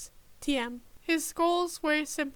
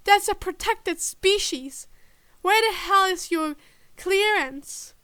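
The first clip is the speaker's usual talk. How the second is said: higher, louder, heated demand; angry demand; 4 semitones higher, 5 dB louder than usual. A narrator's reading. Tim challenging the agent, angry. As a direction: demand his clearance, furious.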